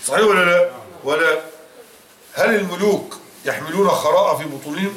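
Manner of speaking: normal talking voice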